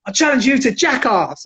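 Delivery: imitating a drunk calling someone out; drunken challenge. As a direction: throw down a dare to the whole club. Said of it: "he's drunk, right?".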